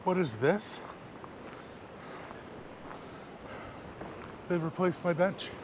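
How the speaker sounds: taken aback by the new bench; surprised. Real, not acted. Finds his old bench replaced.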